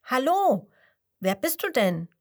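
high, loud friendly call. Acted. Squirrel calling down to the frog, bright call. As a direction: call across to the stranger. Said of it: "Dort ruft es".

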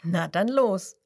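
usual speaking voice

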